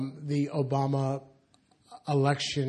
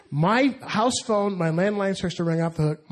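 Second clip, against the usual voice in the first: ordinary delivery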